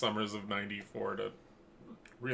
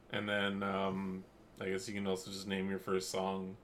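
ordinary delivery

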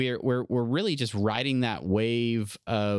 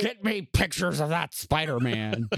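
high, barked editor demand; barking newspaper editor; 5 semitones higher than usual. Pretend. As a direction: bark orders like J. Jameson. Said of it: "I'm the mayor that".

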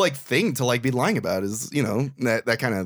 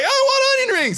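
very high mock outburst; high-pitched pretend demand; 4 dB louder than usual. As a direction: imitate a comic drunk's insistent demand, pitched way up. Drunk star's demand acted again.

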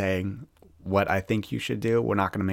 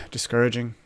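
sighing; 3 semitones higher than usual. Real, not acted. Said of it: "it's just so".

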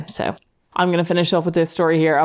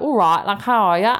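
hammed-up English accent, pitched up; 4 semitones higher than usual. A date imitating her catfish's greeting, mock English accent. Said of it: "he just comes out with this English accent".

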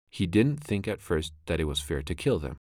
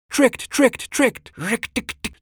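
very high, loud chattering triumph; gleeful mongoose chuckle; 9 dB louder, slower than usual. A narrator's reading. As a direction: crow over the snake like a little animal. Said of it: "chuckled Rikki Tikki".

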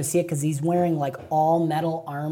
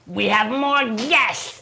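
mock shout; 5 semitones higher, much slower than usual. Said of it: "So he's gonna shout out".

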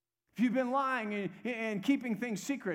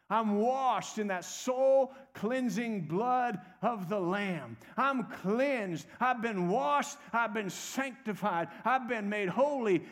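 loud, raised-pitch preaching with stretched key words; full-force preaching; 4 semitones higher, 5 dB louder than usual. Acted. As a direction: preach it hard, rallying the congregation.

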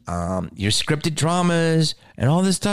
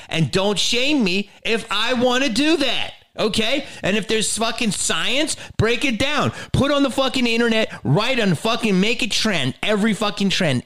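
high-pitched indignant rant; worked-up rant; 8 semitones higher than usual. Real, not acted. Comedian demands the science be laid out, worked-up rant.

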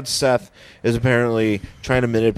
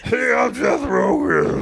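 slow, low Yeti voice; a deep lumbering Yeti growl; Seth Rogen impression; 9 semitones lower, slower than usual. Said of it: "why does he sound like a Yeti".

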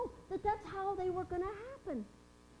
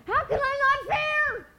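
a slow, pleading cry, higher and louder; incredulous fear; 7 semitones higher, 9 dB louder, slower than usual. Acted. Mary answering the angel's fear not, pleading and afraid. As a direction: ask it as if shaking with fear.

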